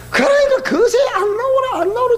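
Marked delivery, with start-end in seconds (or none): 1.1-2.1 mock grumpy husband call; impatient old husband calling. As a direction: yell for her to hurry.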